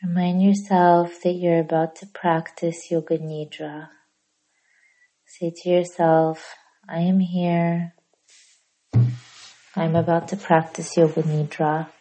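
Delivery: hushed yoga nidra guide, long pauses; soft, unhurried relaxation voice; guided meditation. Real, not acted.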